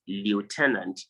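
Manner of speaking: lieutenant as lee-oh-TEN-ant; common mispronunciation. Shown as a demonstration. Teacher citing what some persons say, lieutenant as lee-oh-TEN-ant. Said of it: "some persons will pronounce it".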